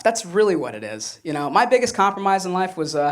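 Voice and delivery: normal talking voice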